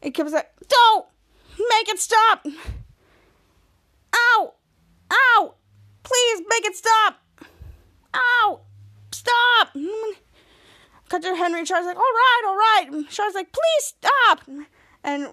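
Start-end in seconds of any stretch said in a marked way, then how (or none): 0.7-9.8 high, loud yelps and pleas; hammed-up wailing to make it stop; mock pained begging. Retold man poked, begging. 12.0-12.9 higher, louder acted objection; mock frantic. Retold frantic reaction to video. 13.5-14.4 high, loud begging; hammed-up cry for it to end; mock desperate plea.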